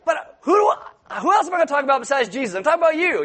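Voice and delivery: high, fast, laughing protest; husband protesting to his wife, high and laughing; mock protest. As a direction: play it as an innocent husband's whine. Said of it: "I'm like".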